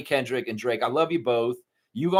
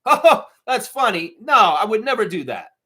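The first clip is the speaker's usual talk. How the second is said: mock amused; 7 semitones higher, 6 dB louder than usual. Acting innocent reaction.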